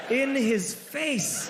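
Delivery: ordinary delivery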